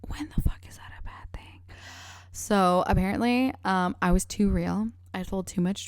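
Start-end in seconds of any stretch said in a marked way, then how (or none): 0.1-1.6 sharp whispered aside; whispering. Real, not acted. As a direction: hiss it under your breath.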